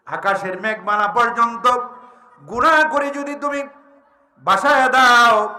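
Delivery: high, rising sermon delivery; full-force preaching. Real, not acted. Preacher warning the congregation about sins, forcefully proclaimed. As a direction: build upward and ring out the last words.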